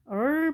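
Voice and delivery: 얼 as a held English "er"; curled-tongue R. Shown as a demonstration. Teacher reminding learners of R, 얼 with curled tongue. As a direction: curl the tongue back on 얼. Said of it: "혀를 구부려준다고 해서요".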